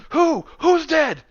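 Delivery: high, loud frantic cry; panicked Norin. Acted. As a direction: shriek in a panic after the lights return. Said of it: "you hear Norin goes".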